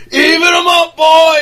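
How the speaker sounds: loud, high holler; hammed-up shouted command; mock villain bellow. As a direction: imitate a movie villain yelling an order. Quotes villain calling in Leatherface.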